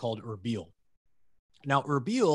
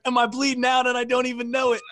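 high, loud imitation of panic; retold freak-out; 10 semitones higher, 6 dB louder than usual. Gunner hit by shrapnel fears bleeding out, freaking out. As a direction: mimic a buddy convinced he's dying. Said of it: "he's just freaking out about".

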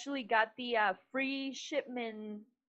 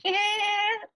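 show intro; 10 semitones higher, slower than usual. Acted. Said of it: "show intro line".

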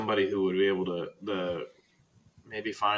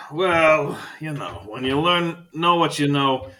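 put-on higher, louder eccentric old man; Faust explaining; 5 semitones higher, 6 dB louder than usual. Librarian explaining his hidden rooms, eccentric old man. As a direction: lecture like a proud old eccentric. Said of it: "And he goes".

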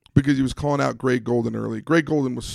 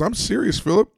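a sharp, raised-pitch mock protest; perturbed retort; 9 semitones higher than usual. Cornerback snapping back at his taunting quarterback, perturbed. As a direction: voice the perturbed teammate, pitch jumping up. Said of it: "He was kinda perturbed".

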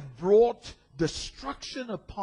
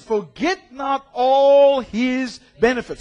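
proclaimed scripture; 6 semitones higher, 7 dB louder, slower than usual. Acted. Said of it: "He says".

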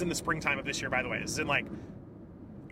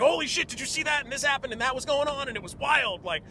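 high-pitched, louder excited rave; excited gushing; 10 semitones higher, 4 dB louder than usual. Acted. Telling someone about a movie you saw, genuinely excited. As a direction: gush like someone bursting to share a story. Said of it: "genuinely excited and talking about something".

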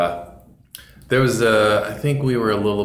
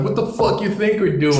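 high-pitched defiant retort to a cop; mouthing off; 10 semitones higher than usual. Retold or imitated. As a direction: imitate a friend talking back. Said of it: "He was mouthing off to the cop".

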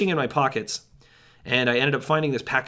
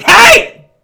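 huge, high yell; full shout; 19 dB louder than usual. Retold or imitated. Startled hiker yelling at snapping twigs behind him, a full shout. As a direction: bellow it at the dark woods. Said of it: "I turned and I shouted".

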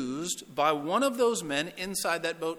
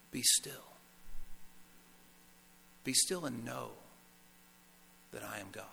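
whispered, fading away to nothing; soft whisper; 4 semitones lower, 10 dB quieter than usual. Pretend. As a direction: breathe it gently to the listener. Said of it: "God is speaking to you and saying".

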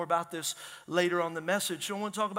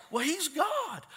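higher, sing-song mock excuse; mock dismissive; 7 semitones higher, slower than usual. Pastor mocking the excuse that he's God, higher sing-song. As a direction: parody the shrugging churchgoer.

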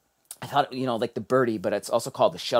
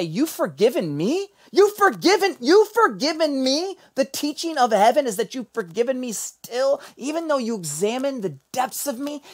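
high, wondering plea to God, far above his usual voice; awed disbelief; 11 semitones higher than usual. Acted. Pastor prays in awe that God forgives him, high and wondering. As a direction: marvel that he is forgiven.

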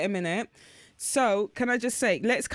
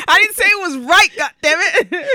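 high, loud comic protest; loud, amused outburst; mock-defensive shout; 10 semitones higher, 11 dB louder than usual. Real, not acted. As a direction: protest loudly through the joke. Mock-defensive outburst amid laughter.

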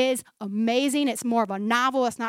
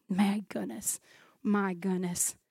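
low, soft aside; quietly marvelling; hushed awe; 4 semitones lower, 9 dB quieter, slower than usual. Real, not acted. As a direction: drop right down, almost to yourself. Hushed aside after reading.

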